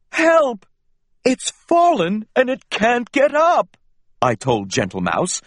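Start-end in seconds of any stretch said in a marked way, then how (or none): 0.1-3.8 high, loud call for help; alarmed cry. Acted. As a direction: panic a little for the bird. Finds fallen bird, calls for help.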